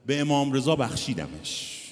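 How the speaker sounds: loud cry of forgiveness; shout. Retold or imitated. Mother of the victim shouting her forgiveness aloud. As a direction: cry it out for everyone to hear. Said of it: "فریاد زد".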